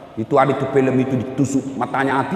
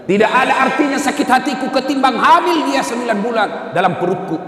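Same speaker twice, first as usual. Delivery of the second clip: higher, pleading mother's plea; raised pleading; 10 semitones higher than usual. Acted. Mother telling the Prophet about carrying her son, raised pleading. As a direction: lift it into an anguished plea.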